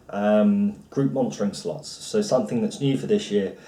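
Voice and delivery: usual speaking voice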